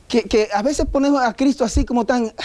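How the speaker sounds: ordinary talk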